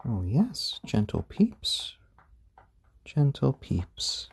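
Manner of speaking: usual speaking voice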